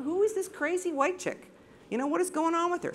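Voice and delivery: high, quick bemused voices; imitating puzzled fishermen. The fishermen wonder about the woman yelling at them, high and bemused. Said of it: "And they're going".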